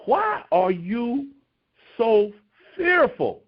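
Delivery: each word drawn out slowly, rising high on fearful; deliberate, weighty question; slow rebuke. Retold or imitated. Jesus questioning his frightened disciples, slow drawn-out rebuke. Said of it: "He says".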